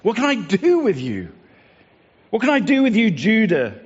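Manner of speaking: exasperated plea pitched high, pushing harder on each ask; pleading God. Acted. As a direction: ask like a parent out of options. God pleading with his people.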